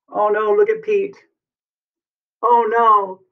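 high-pitched storybook alarm; dramatic dismay. Acted. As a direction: gasp out the bad news for young listeners. Dismay at Pete being roped.

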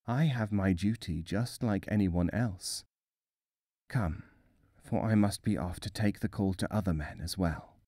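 quiet, dutiful reaper; grim reaper voice. A narrator's reading. Angel of Death speaks.

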